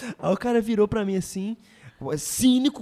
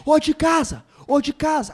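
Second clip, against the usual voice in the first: high, loud call from outside the house; retold shouting; 9 semitones higher, 5 dB louder, slower than usual. Impatient father calling the house owner from outside, shouting. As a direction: yell it up at the house like an impatient dad. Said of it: "Aí ele começou a gritar".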